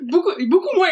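loud, high words through laughter; laughing. Real, not acted. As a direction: laugh while you talk.

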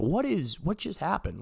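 high, hushed bewilderment; imitated shock. Dazed man after being punched, high soft shocked voice. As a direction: ask it high and small, stunned. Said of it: "I'm just in shock".

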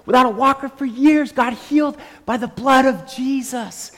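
high, slow proclamation to a crowd; street preaching. Retold or imitated. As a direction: declare it out loud, stretching key words.

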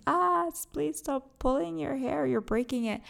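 high-pitched pained cry of protest; pained pleading. Retold or imitated. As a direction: beg them to stop, wincing in mock agony. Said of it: "it, it really, really pains me".

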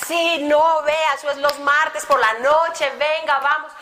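bursting with joy. Retold or imitated. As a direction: gush with delight at the invite. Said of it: "El hombre no cabía de la alegría".

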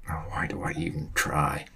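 whispered, giving up; exasperated whisper. Acted. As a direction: breathe it out in defeat. Ollie gives up, whispered.

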